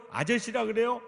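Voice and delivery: usual speaking voice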